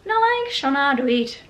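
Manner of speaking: amateur Irish accent, pushed up in pitch; attempted Irish accent. Shown as a demonstration. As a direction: have another try with an Irish lilt. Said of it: "I'll try and say it in an Irish accent".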